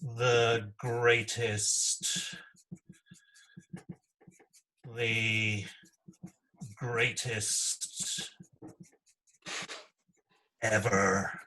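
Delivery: each word stretched out very slowly; slow, drawn-out emphasis with long pauses; elongated. Shown as a demonstration. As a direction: drag out the greatest like a Trump slogan. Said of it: "I think you need to elongate that".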